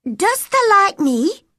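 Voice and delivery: high, loud, lightly Yorkshire question; Yorkshire dialect. A narrator's reading. Girl asking the boy in Yorkshire dialect, high and loud. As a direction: ask in broad Yorkshire, shyly. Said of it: "a question in Yorkshire dialect".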